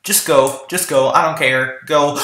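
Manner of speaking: ordinary talk, nothing marked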